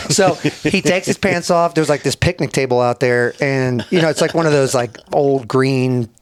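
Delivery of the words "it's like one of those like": ordinary talk, nothing marked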